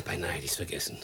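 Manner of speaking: soft unvoiced whisper; an afterthought, whispered. Servant remembers the radio.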